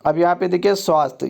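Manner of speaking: ordinary talk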